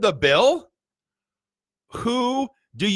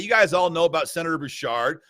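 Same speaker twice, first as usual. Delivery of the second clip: ordinary talk, nothing marked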